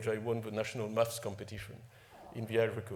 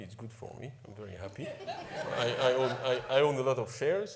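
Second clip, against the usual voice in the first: ordinary talk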